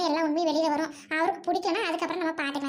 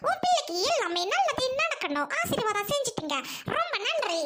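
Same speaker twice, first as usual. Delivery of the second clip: prayer; 3 semitones higher than usual. Acted. Prays in church.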